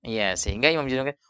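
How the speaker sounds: normal talking voice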